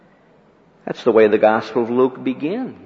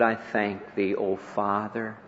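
reverent hush; 5 semitones lower, 10 dB quieter than usual. Acted. Quoting Jesus' prayer reverently.

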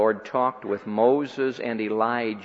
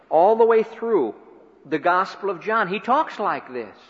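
preaching at full force; 7 semitones higher, 6 dB louder than usual. Acted. Preacher to the congregation, building to the hour of the cross, forceful and climbing. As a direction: raise it higher and louder, pressing home each line.

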